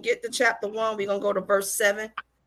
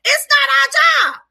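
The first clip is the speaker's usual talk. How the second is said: high, loud, drawn-out shout; preacher's shout; 10 dB louder, slower than usual. Real, not acted. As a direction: hammer it home at the top of the voice. Climax of pulpit rebuke.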